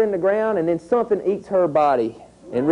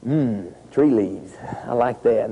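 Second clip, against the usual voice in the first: lower, slow, savoring deer voice; a deer happily munching tree leaves; contented deer; 7 semitones lower, slower than usual. Acted. Teacher voicing a deer for the class, lower and slow. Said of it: "a deer walks along and goes".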